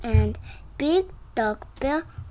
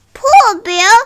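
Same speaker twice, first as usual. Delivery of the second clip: loud exclaim; 9 semitones higher, 15 dB louder than usual. Acted. Character exclaims in story.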